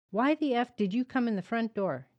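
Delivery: loud, furious demand; angry barrage. A narrator's reading. Father berating his son for the open door, loud fury. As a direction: tear into the boy. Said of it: "Dad continued his barrage of insults".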